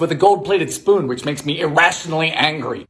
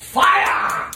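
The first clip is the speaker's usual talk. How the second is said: excited call; 11 semitones higher than usual. Real, not acted. Announcing the stove heat.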